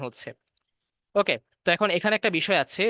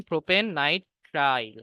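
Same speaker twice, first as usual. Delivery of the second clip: Propane nitrile said slowly, each word drawn out; slow, spelled-out name; much slower than usual. Shown as a demonstration. Teacher slowly spells out the name for students. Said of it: "তিন carbon মানে propane".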